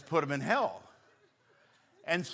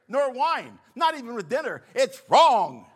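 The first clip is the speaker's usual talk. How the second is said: higher, louder pious indignation; self-righteous; 7 semitones higher, 9 dB louder than usual. Retold or imitated. Pastor parodying his strict church condemning wine at dinner. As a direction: preach it with mock outrage.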